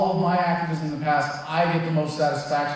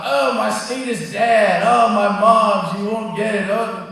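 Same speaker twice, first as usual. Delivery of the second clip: slow, louder mock complaining, mom line much louder; mock whining; 3 semitones higher, 6 dB louder, slower than usual. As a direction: lampoon a self-pitying libertarian whiner. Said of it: "bitching nonstop".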